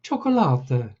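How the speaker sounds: high, chirpy little voice; little cousin chiming in, chirpy; chirping little boy. Acted. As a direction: pipe up like a small bird. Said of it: "chirped in Giuseppe".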